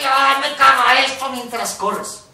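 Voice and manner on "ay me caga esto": a loud, high burst of annoyance; fed-up outburst. Shown as a demonstration. Coach demos a loud, high fed-up outburst while running. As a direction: blurt out your frustration, louder and higher. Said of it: "y vas corriendo y".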